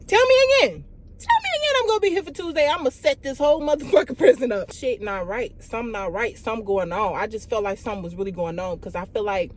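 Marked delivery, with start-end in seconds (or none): 0.1-4.7 sudden loud, high outburst; furious threat. Retold or imitated. Daring the staff to repeat it, loud and high. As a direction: snap into a fast, loud dare.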